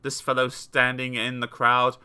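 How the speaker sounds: ordinary delivery, nothing marked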